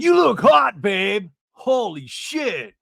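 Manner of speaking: high, loud hammed-up admiration, slowing at the end; retold wowed boyfriend. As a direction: shout it, imitating an impressed man. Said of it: "And he's like".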